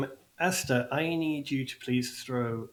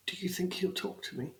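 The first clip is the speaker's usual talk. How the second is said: small, high, soft question; tearful halfling; 6 semitones higher, 8 dB quieter than usual. Acted. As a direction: ask timidly through acted tears. Crying halfling asks for reassurance.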